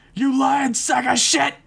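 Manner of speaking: high, drawn-out enraged scream; furious accusation. A narrator's reading. Jason calling Rio a liar, furious high scream. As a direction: scream the accusation, slowly stretched.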